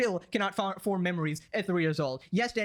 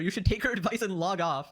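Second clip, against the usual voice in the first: ordinary talk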